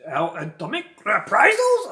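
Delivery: over-the-top ranting villain, high and loud; General Orlov. Retold or imitated. Reviewer imitates a bonkers Bond villain, loud hammed-up outburst. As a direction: play it to the hilt, loud and off tap. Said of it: "Steve Berkoff plays him".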